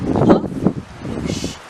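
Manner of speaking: loud puff then a whoosh. A narrator's reading. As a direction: blow the house down. Wolf blows house down.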